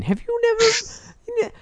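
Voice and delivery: very high, cracking into a laugh; squeaky breaking voice. Acted. Host squeaks at his co-host until his voice breaks.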